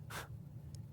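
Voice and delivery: quiet airy laugh under the breath; a hushed private chuckle; soft breathy chuckle. Acted.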